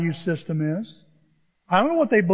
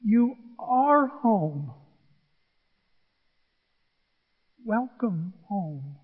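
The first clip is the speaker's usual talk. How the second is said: very slow, softening reassurance; tender welcome; much slower than usual. Retold or imitated. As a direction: draw each word out, welcoming him gently. Lady tenderly welcomes the tearful fan.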